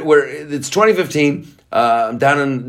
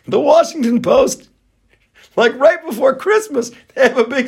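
laughing; 3 dB louder than usual. Real, not acted.

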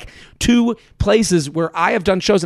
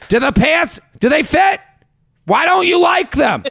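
high, raised-voice parental grilling; nagging parent; 10 semitones higher, 3 dB louder than usual. Acted. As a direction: pester the kid from outside the fitting room. Parent voiced outside a fitting room.

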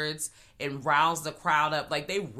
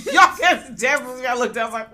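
high, loud laughing burst; 8 semitones higher, 8 dB louder, faster than usual. Real, not acted. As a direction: crack up and talk through it.

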